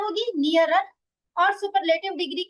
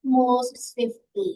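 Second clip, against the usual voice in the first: "Most swiftly" very slow and much lower; drawn-out answer; 6 semitones lower, much slower than usual. Shown as a demonstration. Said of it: "आप क्या कहोगे?". ("Most swiftly" को बहुत धीमे, नीची आवाज़ में खींचकर)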